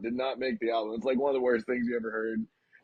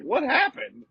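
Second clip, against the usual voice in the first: high, loud mock demand; a hammed-up bewildered bandleader asking; 9 semitones higher, 5 dB louder than usual. [name] asking the band what happened, mock indignant. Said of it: "And then he's like".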